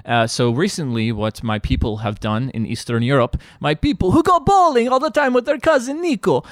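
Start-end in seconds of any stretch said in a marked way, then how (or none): 3.6-6.4 mock Slav bro. Bosnian guest mocking his own people, high put-on voice. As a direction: lampoon the tracksuit cousins.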